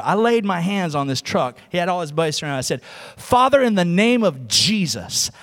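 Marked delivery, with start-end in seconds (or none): 3.2-5.3 slow, loud, drawn-out prayer; re-enacted preacher's prayer; bold prayer. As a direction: pray it out over a broken truck. Re-enacts bold prayer over truck.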